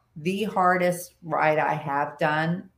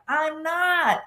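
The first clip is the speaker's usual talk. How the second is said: emphatic denial; 12 semitones higher than usual. Retold or imitated. Insisting to Roger.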